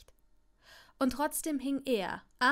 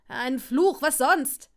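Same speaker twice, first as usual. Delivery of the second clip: exasperated shout; 11 dB louder, slower than usual. A narrator's reading. Draco telling Longbottom to curse the spider, shouting. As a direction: throw it back loudly.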